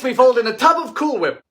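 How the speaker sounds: ordinary delivery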